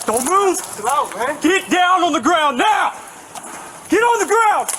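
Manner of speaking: high, urgent police orders; shouted surrender demands. Real, not acted. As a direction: command him down. Officer commanding suspect.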